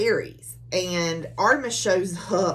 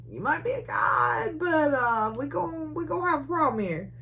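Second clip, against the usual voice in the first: higher, sassy mock warning; snarky threat; 9 semitones higher than usual. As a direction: warn a goddess off with attitude. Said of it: "Alex gets kinda snarky with her".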